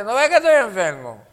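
high, loud sick person's excuse; mock protest. Preacher mimicking the sick who refuse to worship, loud mock protest. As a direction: protest loudly as the imitated patient.

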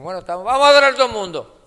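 loud, high, rushed call to everyone; mock rallying shout. Preacher imitating churches that order everyone to worship, rallying shout. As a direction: imitate a leader hollering to rouse the church. Mimics church leader rousing the congregation.

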